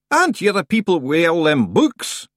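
loud, demanding rustic stranger; Scottish-tinged gruff stranger. A narrator's reading. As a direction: bark the question at the librarians. The figure demands.

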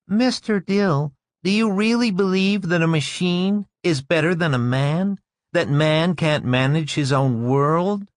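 put-on high-pitched, thin little girl challenging an adult; thin, high child questioning the teacher; thin child's voice. A narrator's reading. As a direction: pipe up as a small, direct child. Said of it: "the thin child's voice".

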